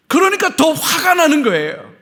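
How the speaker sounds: loud, high outburst; emphatic shout. Real, not acted. Preacher booming at the congregation after a whisper. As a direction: fling it out to the congregation.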